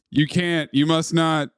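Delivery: normal talking voice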